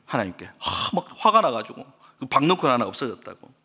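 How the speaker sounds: ordinary talk, nothing marked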